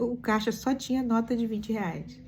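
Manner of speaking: normal talking voice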